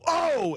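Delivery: high, sharp imitated cry of pain; gunshot groan. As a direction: mimic a man hit by a bullet, a sudden high yelp. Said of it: "you hear him go".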